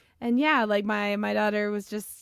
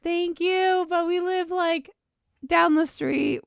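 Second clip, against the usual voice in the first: higher-pitched imitation of declining help; retold polite refusal; 8 semitones higher, 4 dB louder than usual. Parent turns down a passerby's offer, retold in a higher voice. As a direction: politely brush off a stranger, pitched up. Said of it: "I was like".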